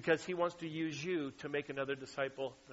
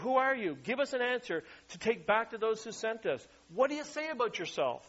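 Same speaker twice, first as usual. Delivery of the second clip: demanding questioners; 6 semitones higher than usual. Retold or imitated. Priests' envoys demanding John name himself, raised insistent voice. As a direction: fire the questions at him like an envoy.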